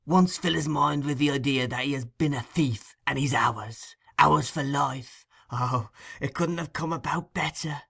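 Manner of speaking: high, wheedling villain's voice. A narrator's reading. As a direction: savour every word of the scheme.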